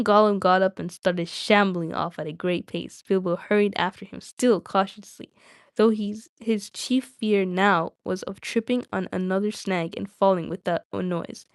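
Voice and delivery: plain reading voice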